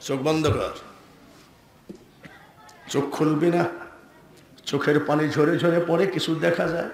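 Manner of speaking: low, soft storytelling voice; hushed instruction. Acted. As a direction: guide the devotee quietly, voice dropped. Saint instructs devotee in story. (নিচু, শান্ত গলায়)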